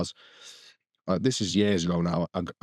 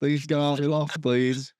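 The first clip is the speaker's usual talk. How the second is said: mock plea; 4 semitones higher than usual. Re-enacts pleading with the bird.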